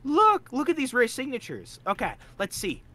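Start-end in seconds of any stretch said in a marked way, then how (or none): 0.0-1.8 excited look. Real, not acted. Creator shows the viewers rare signatures, excited. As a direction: show off your treasure, thrilled.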